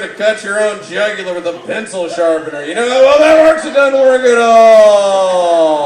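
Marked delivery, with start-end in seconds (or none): 2.6-5.9 much higher, louder, slow shouted punchline; mock yell. Yelling the roast punchline at Dilbert. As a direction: bellow the punchline.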